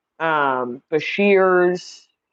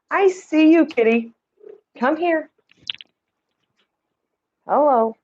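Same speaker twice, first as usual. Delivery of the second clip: higher, coaxing pet voice; sweet cat talk; 9 semitones higher than usual. Real, not acted. Host calls her cat over in a higher coaxing voice. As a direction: coax the kitty over, sing-song.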